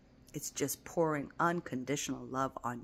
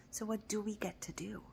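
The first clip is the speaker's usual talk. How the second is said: whisper; 3 semitones higher, 9 dB quieter than usual. Shown as a demonstration. Repeats the question twice.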